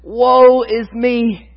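very slow, heavy lament; Isaiah undone, slow woe; drawn-out woe. Acted. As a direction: stretch it out in despair. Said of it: "his response is".

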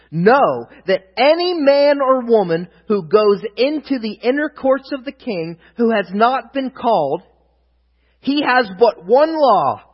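slow, weighty proclamation, easing at the end; emphatic scripture reading. A narrator's reading. Preacher reading Esther's warning, slow emphatic proclamation.

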